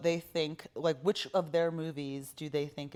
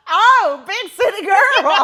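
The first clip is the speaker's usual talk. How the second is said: loud, high hollered Oklahoma twang; hammed-up, drawn-out Oklahoma accent; 14 semitones higher, 16 dB louder, slower than usual. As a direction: mimic an Oklahoma accent, hollering through laughter. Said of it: "cackling and hollering at your Oklahoma accent".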